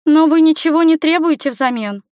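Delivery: put-on a young girl puzzled by his offer; questioning girl. A narrator's reading. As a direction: ask it high, as the girl. Girl questions her rescuer.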